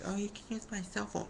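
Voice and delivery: put-on a second old lady offers her phone, high and kind. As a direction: offer it like a friendly grandma. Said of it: "she was just like".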